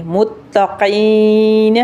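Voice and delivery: very slow "متقین" leaning on the doubled ت; drawn-out "متقین" with a hard double t; firm taa. Shown as a demonstration. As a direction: press the ت of "متقین", then hold the ee long. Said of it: "تا کے اوپر تھوڑی سی سختی کریں گے".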